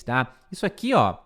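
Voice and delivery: normal talking voice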